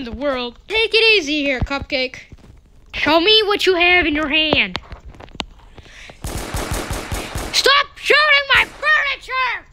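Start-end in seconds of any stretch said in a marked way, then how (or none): 6.2-9.7 very high, slow drawn-out cry; much higher, slow panicked yell; alarmed yell. Real, not acted. Dog shooting furniture.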